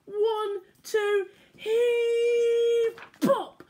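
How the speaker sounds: loud, high straining heave; heave-ho effort. Acted. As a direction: shout it like hauling a stubborn weed. Pulling weeds together.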